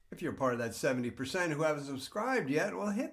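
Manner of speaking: loud, high ad read; energetic channel promo; subscribe plug. Acted. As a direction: hype the subscribe button.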